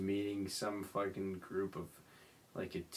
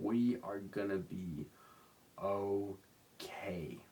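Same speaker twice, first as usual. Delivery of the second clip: whispered reassurance; much slower than usual. Real, not acted. Reassuring listeners softly.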